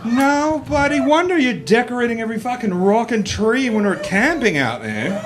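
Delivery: high, loud marvelling voice; amazed girlfriend. Retold or imitated. Comic voicing his girlfriend discovering male plumbing, amazed. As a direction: exclaim it in comic outrage and awe.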